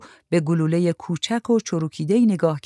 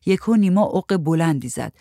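ordinary delivery, nothing marked

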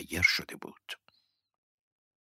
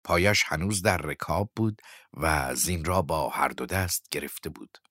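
ordinary delivery, nothing marked

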